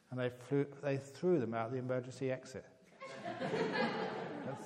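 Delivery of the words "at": words broken by laughter, slow; laughing while telling. Real, not acted. Telling the joke to the audience, laughing through it.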